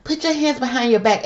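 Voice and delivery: lightly voiced mock cop command; imitated police yelling. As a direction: echo the cop's command with scorn. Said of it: "As they yelling".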